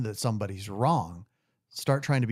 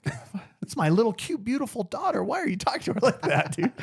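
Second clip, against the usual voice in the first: protective outrage; 11 semitones higher than usual. Retold or imitated. His protective retort retold.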